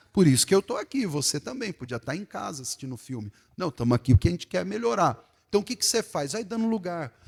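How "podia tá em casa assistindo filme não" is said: ordinary talk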